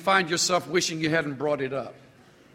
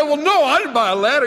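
widow voice; 8 semitones higher, 5 dB louder, faster than usual. Retold or imitated. Widow answers in joke.

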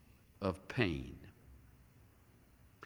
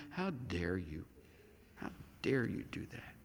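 quiet indignation; 6 semitones lower, 13 dB quieter than usual. Pretend. Voicing people shaking fists.

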